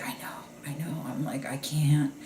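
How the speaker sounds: quiet, near-whispered commiseration; very soft, conspiratorial retelling; retold hushed confiding. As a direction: imitate a furtive exchange under the breath. Two group members confiding privately.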